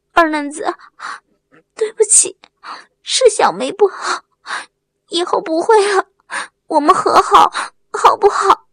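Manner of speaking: high, pleading voice with catching sobs; tearful apology. A narrator's reading. Wang Mei asks Erlengzi to make up, tearfully.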